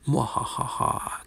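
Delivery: slow whispered evil cackle; breathy villain mwahaha; evil laugh. Pretend. Evil laugh at command center.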